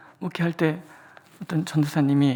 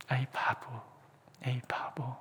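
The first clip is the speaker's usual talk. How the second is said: whispered self-scolding; 3 semitones lower, 14 dB quieter than usual. Retold or imitated. Self-reproach retold.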